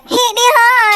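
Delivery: high mock-female chant; imitated woman's voice. Son imitates dad's lady friend, high mock voice. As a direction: pitch it up like her. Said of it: "And she said".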